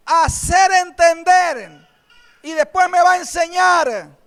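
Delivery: loud, high fervent preaching; fervent pulpit preaching; preaching at full force. Acted. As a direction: proclaim it to the congregation. Preaching about papá's promises.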